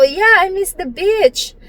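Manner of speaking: high, drawn-out mock American accent; prettified English. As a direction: show off a careful American accent. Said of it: "paganda-ganda ko pa yung salita ko".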